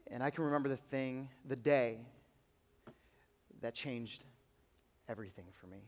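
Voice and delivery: hushed personal story; quiet testimony. Acted. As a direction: draw them in with a hushed memory.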